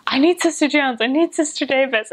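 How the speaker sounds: high, urgent mock holler; imitating super loud man. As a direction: call out as if demanding them right now. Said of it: "he's just, like, super loud".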